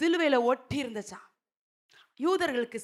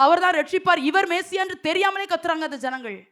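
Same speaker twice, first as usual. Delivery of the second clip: ordinary delivery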